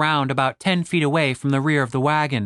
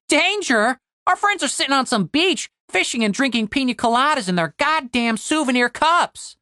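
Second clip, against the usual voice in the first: high, mocking disbelief; amused scoff; 9 semitones higher than usual. A narrator's reading. Tim laughs off Cooper's worry, mocking. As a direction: scoff at the idea, pitch way up. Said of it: "Tim laughed".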